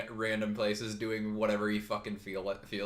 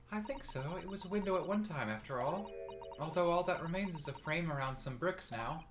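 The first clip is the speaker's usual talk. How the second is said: put-on lifted, light girlish read; higher female character voice; 7 semitones higher, 5 dB quieter than usual. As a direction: voice the assistant, higher.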